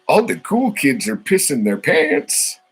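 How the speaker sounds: hammed-up high comedy voice; movie-line impression. Quotes an Adam Sandler movie line.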